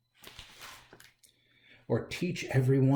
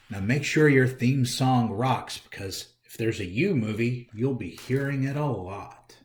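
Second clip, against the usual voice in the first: plain reading voice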